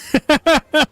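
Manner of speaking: squealing burst of laughter; high laugh. Real, not acted. Laughs at own joke.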